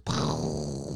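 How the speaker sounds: low sputtering noise with the lips; ego noise. Retold or imitated. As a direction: blow a long, low sputter. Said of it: "my ego just goes".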